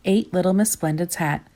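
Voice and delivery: ordinary delivery, nothing marked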